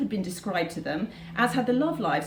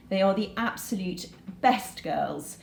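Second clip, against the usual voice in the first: ordinary delivery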